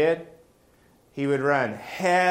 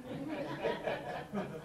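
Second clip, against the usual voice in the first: laughing; 14 dB quieter than usual. Real, not acted. Laughing at own joke.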